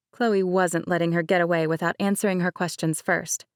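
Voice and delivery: ordinary delivery, nothing marked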